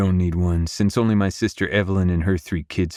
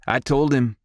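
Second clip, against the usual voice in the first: put-on higher-pitched young boy's interjection; interjecting boy; 6 semitones higher than usual. A narrator's reading. Wilder cutting in on his granddad, interjecting. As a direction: cut in quickly as the older son. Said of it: "Wilder interjects".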